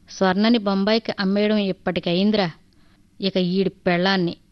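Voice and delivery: ordinary delivery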